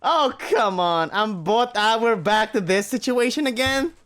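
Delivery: complain, fed up; exasperated whine. Acted. Exasperated protest.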